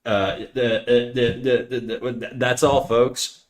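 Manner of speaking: mock cartoon stammer into a sign-off; comically stuck, stuttering sign-off; stuttering catchphrase. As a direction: stutter on repeated little syllables before getting the phrase out. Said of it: "Snacker's a stuttering hunk".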